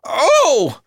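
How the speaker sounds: high, loud startled yelp; startled cry. A narrator's reading. Mother jumps at the professor's shout, crying out startled. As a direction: jump with fright, voice shooting up. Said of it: "it made Mother jump".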